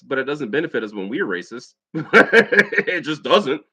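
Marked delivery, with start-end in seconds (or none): 1.8-3.6 loud laugh running into the words; real laughter. Real, not acted. Telling viewers it doesn't benefit them, laughing. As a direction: chuckle through a blunt conclusion.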